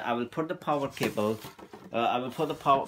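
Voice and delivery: usual speaking voice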